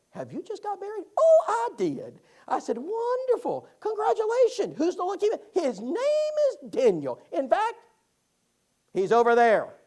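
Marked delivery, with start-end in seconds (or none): 0.1-2.2 high-pitched, gushing woman's reply; mock high female voice, drawn out; woman at the door. Retold woman answering at door. 2.8-5.4 high, loud mock-delighted congratulations; hammed-up enthusiasm; gushing congratulations. Retold gushing congratulations at door. 5.5-9.7 high, drawn-out name turning loud and heavy; changed tone. Retold or imitated. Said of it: "her whole tone changed when she said that".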